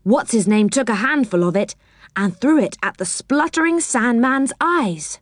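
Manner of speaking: plain reading voice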